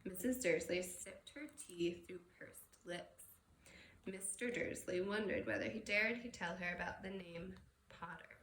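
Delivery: plain reading voice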